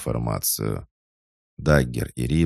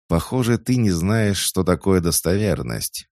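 higher and louder, with a snort of scorn; scoffing; 6 semitones higher, 4 dB louder than usual. A narrator's reading. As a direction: scoff at him dismissively. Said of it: "фыркнул Гвен".

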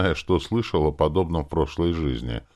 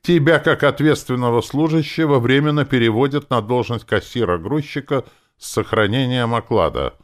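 loud, high official announcement; officious proclamation; bureaucratic tone; 9 semitones higher, 6 dB louder than usual. A narrator's reading. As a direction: read it out like a formal decree. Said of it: "продолжил я казённым тоном".

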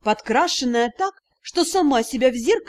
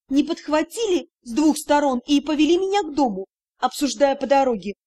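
ordinary delivery, nothing marked

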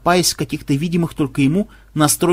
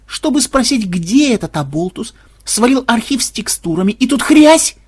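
indignant; 6 semitones higher, 4 dB louder than usual. Acted. Indignant programmer retelling.

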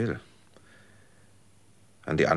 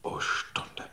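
hushed awe; 4 dB quieter than usual. Acted. Savouring the hour he won.

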